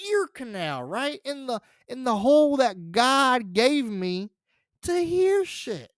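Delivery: normal talking voice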